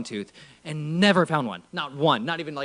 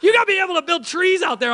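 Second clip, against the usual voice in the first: a touch of mock protest; 12 semitones higher than usual. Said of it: "shaking your heads like".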